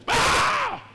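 high, loud angry yell; sudden shouted cry of temper; angry outburst. Acted. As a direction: burst out like a hothead. Mimicking hot-tempered troublemaker.

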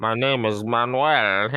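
low, slow movie-villain voice with a laugh; hammy low villain introducing himself; Bane. Acted. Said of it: "Is that a good Bane impression".

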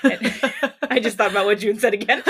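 loud real laughter through the words; laughing. Real, not acted. Reader remembering a friend's remark, laughing loudly. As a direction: break up laughing while talking.

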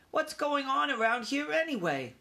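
put-on high-pitched questioning young mouse; young mouse wanting answers; puzzled young mouse. A narrator's reading. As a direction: ask it in a small, high voice. Young mouse questions his flustered family.

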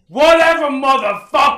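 high, loud yelled quote; shouted movie quote. Retold or imitated. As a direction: scream it like the movie line. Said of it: "My favorite gift to send".

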